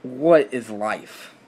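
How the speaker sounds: milk the exasperation; mock despair. Real, not acted. Teen lamenting to viewers, slow mock-dramatic.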